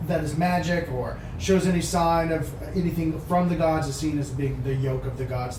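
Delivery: normal talking voice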